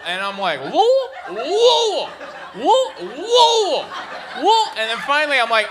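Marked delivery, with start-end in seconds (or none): 0.7-4.7 "Wool" hooted very high and loud, like a howl; failed pronunciation attempts. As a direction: try and fail at Louisville, "Wool" as a mouthful of vowel. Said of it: "I can't say it".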